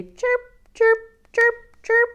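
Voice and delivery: high chirps; mama bird chirp. Shown as a demonstration. Teacher to children, chirping like a mama bird. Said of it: "A mama bird is gonna say".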